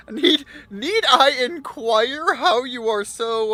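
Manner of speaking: words broken by laughter; fighting back laughter while asking; laughing. Real, not acted. Laughing through a question.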